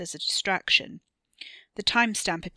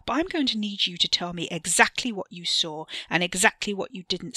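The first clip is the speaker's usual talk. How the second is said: loud, forceful rebuke; furious; 6 dB louder than usual. Acted. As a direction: hammer every demand home. Ricky furious, demanding ownership.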